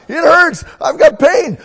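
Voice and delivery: very high, loud pained shout; runner in pain. Acted. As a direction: yell out the pain. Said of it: "as that runner gets tired".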